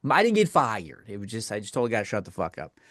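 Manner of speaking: usual speaking voice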